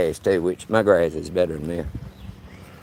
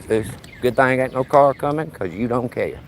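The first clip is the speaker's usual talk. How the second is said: ordinary talk, nothing marked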